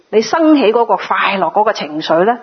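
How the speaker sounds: ordinary talk, nothing marked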